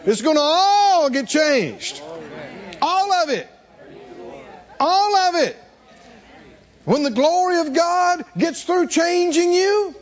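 high, ringing preacher's build; preaching at full force. Acted. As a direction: lift the congregation with joyful fervor. Rising exhortation.

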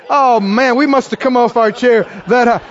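ordinary talk, nothing marked

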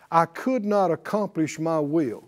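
ordinary talk, nothing marked

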